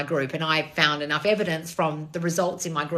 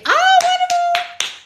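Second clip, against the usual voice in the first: much higher, slow, sing-song "Oh, wonderful!"; gushing mock delight; 10 dB louder, much slower than usual. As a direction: squeal it like a delighted cartoon. Put-on gushing reaction.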